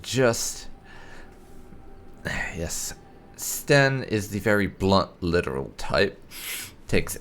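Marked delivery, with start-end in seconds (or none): 1.5-2.2 weary sigh. Real, not acted. Exasperated by blunt companion. 2.2-2.9 a whispered, defeated yes; unvoiced, worn-out reply; resigned whisper. Real, not acted. Resigned after literal answer.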